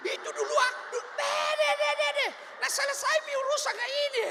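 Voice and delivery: very high, loud exasperated shout; mock exasperated husband. Husband cutting off his nagging wife, high loud shouts. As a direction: shout her down with repeated deh.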